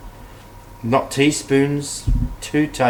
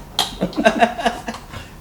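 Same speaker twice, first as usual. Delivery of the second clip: breathy laugh; 12 semitones higher than usual. Real, not acted.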